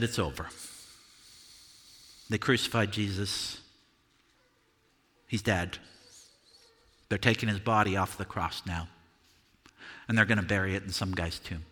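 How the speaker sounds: grieving news. Acted. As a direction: deliver the death news numbly. John brings news of death.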